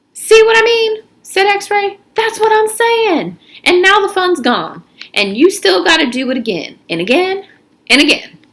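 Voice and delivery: put-on high, loud, pushy teen; cocky teen, high and loud; X-Ray's voice. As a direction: push him, voice up high and loud. X-Ray voiced in story.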